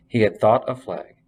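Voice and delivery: ordinary delivery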